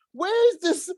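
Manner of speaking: high, exasperated mock rant at the courier; imitating his own squeaky fury; mock outraged rant. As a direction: rant like you can't believe it, pitch way up. Retold rant at delivery driver.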